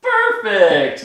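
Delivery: squealed high with a laugh; excited laughing praise. Real, not acted. Producer praising the host's hook, thrilled and laughing. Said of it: "you're getting so good".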